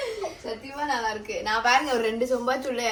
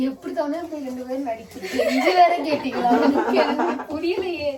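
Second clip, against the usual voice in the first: laughing; 2 semitones higher, 4 dB louder than usual. Real, not acted. Teasing them about acting.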